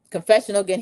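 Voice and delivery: ordinary talk, nothing marked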